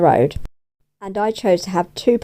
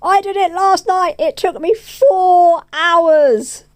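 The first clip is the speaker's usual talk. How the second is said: higher and louder, stretched out slowly on the last part; proud reply; 12 semitones higher, 5 dB louder, slower than usual. Retold or imitated. Telling dad she baked it, higher, louder, slow proud drawl. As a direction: play it up, drawing out the effort it took. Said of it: "It was like".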